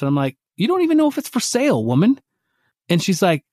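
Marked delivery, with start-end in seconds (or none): 0.6-2.2 high pitched exasperated retort to his wife; incredulous protest. Retold or imitated. Husband protesting to wife about buying the horse, incredulous and high.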